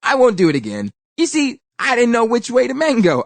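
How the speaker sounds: high, lightly voiced fibbing spider; a hint of a smug trickster; insincere spider. A narrator's reading. Spider fibs to the girl, lightly high. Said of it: "he said insincerely".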